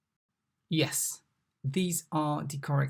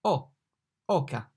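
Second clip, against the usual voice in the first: usual speaking voice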